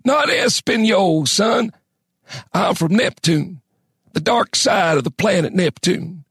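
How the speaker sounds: put-on slow, high old prospector voice; harsh, gravelly old man. A narrator's reading. Old man revealing his origin to Crane, harsh slow voice. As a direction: pitch it high and drag it out, old and creaky. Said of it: "voice was harsh and gravelly".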